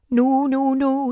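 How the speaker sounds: high, honking goose protest. A narrator's reading. Goose warning the pig off the pail. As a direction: sound the alarm like a fussing goose. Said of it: "said the goose".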